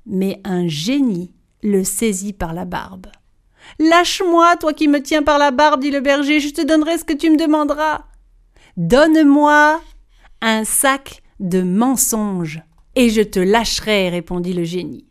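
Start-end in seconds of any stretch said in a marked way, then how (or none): 3.8-8.0 panicked plea. Acted. Shepherd seized by the beard. 8.8-14.1 genie. Acted. Genie demands a bag of lies.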